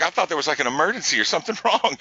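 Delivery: high-pitched mock disbelief, laughing partway through; incredulous retelling. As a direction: exclaim it playfully, breaking into a laugh mid-line. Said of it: "And I was like".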